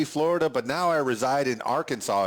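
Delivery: usual speaking voice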